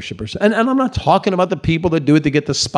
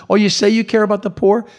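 passive-aggressive challenge; 7 semitones higher, faster than usual. Retold or imitated. Jesus pushing back on the critics, high and sarcastic. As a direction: needle them about caring for the poor. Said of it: "passive-aggressive".

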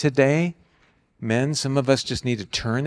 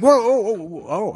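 mock startled whoa; taken aback; 7 semitones higher than usual. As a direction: imitate a guy caught off guard. Retelling guys caught off guard.

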